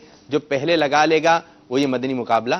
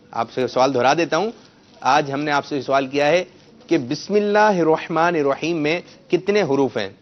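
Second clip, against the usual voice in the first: usual speaking voice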